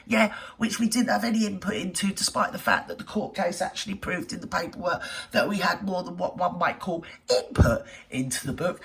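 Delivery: ordinary talk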